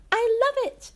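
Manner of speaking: high, loud gushing fake thanks; squealed mock delight. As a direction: gush over a gift you hate. Fake delighted gift quote.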